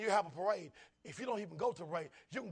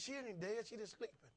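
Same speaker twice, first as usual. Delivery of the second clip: quiet Jesus; 3 semitones higher, 8 dB quieter than usual. Retold or imitated. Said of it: "He said".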